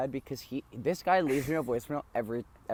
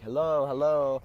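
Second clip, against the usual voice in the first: put-on old guy voicemail; 5 dB louder than usual. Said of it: "some old guy be like".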